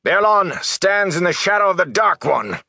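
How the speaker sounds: high, ringing accusing shout. A narrator's reading. Swordsman denouncing the town to the watch, shouting. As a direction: call it out high across the street. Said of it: "He raised his voice to shout at the watch".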